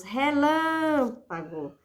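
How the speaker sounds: "Relâmpago" with a very long "lâm"; stress on antepenultimate syllable. Shown as a demonstration. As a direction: stretch "lâm", then drop away on "Relâmpago". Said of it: "é a antepenúltima". ("Relâmpago" devagar, alongando bem o "lâm")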